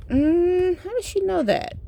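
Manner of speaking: high-pitched mock puzzlement; puzzled partner. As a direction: act out a mystified partner in a high voice. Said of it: "It's like".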